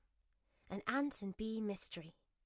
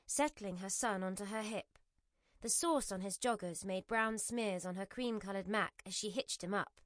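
ordinary delivery

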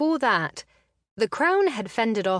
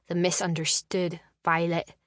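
ordinary delivery

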